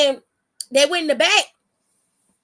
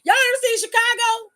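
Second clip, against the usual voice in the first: 1 high excited question; 10 semitones higher, 3 dB louder than usual. Real, not acted.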